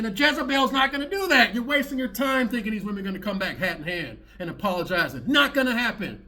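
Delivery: ordinary talk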